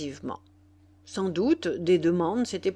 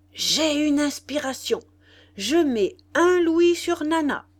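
higher, louder burst of inspiration; excited announcement; 7 semitones higher, 5 dB louder than usual. A narrator's reading. La Faloise announces his bet to friends, excited. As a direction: proclaim a sudden bright idea to everyone.

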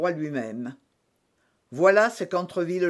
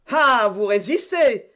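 slow, loud, higher cry; loud exclamation; 4 semitones higher, 6 dB louder than usual. A narrator's reading. Jussac reacts to their resistance, crying out. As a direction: exclaim it at the defiant opponents. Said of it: "s'écria Jussac".

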